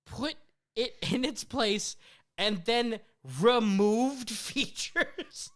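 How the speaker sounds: high, loud, incredulous mock fury; hammed-up outrage with long pauses between bursts; outraged rant. Acted outrage at the phone assistant.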